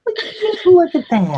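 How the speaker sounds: squeaky-high catchphrase impersonation; Ed Bassmaster. Acted. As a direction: send the whole line way up high. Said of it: "like, Ed Bassmaster voice".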